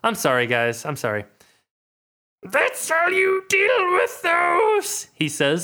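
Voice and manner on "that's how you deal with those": high, slow, strained Yoda-like croak; wheezing old master in agony, slow and high; dying Yoda. Acted. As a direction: play a Yoda dying of something painful. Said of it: "play him as, like, Yoda, but dying".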